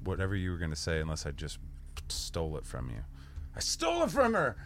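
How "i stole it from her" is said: mock high voice. Mock high voice, self-joking.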